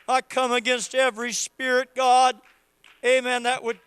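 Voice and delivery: loud, high, impassioned preaching cry; preaching at full force. Acted. Pastor urging the congregation to worship, shouting fervently. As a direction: rouse the whole church to worship.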